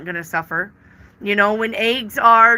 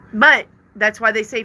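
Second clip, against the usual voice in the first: normal talking voice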